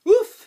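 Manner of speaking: high loud gasp of relief. A narrator's reading. Boy relieved the drill stops, loud gasp.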